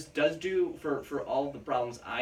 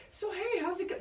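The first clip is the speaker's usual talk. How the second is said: much higher, breezy mock American accent. Mocking a daughter's sudden American accent, breezy and high. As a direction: flip into a cartoonish American accent. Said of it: "Like, that accent".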